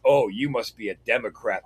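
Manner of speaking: a hint of a sniffy, offended guy; mimicking the offended customer's sniff; mock offended. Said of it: "all offended like".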